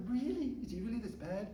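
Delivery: high, startled really; gasping at the news; shocked disbelief. Retold or imitated. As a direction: ask it as if you can't believe it. Shocked at the husband's threats.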